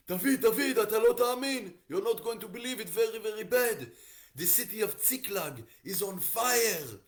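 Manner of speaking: high, loud frantic report; panicked messenger. Acted. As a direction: shout terrible news in a panic. Messenger brings terrible news to David.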